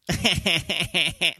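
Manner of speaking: high, mocking imitation of her laugh; imitated girlfriend's laugh. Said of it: "That's how she laughed".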